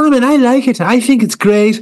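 high, gushing judge voice; mock Louis Walsh. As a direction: gush at the wallpaper like a star judge.

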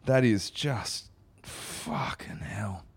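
slow stunned whisper. Real, not acted. Whispering to co-host after the awful poem, slow whisper. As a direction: breathe it out in disbelief.

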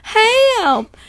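mock scream for help, very high and loud; shrieked cry with a long held vowel; SpongeBob. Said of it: "SpongeBob just screaming".

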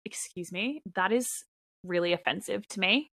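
quiet, prim mock offence; telling off someone who asked if she's a Kiwi, quiet mock offence; mock offended. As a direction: pretend to be deeply insulted. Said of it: "I'll be like".

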